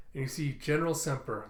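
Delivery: normal talking voice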